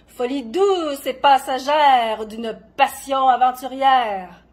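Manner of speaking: loud, slow, soaring verse; impassioned declamation. Acted. Poet answers what madness is, exalted declamation. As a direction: proclaim it grandly, drawing out the stressed words.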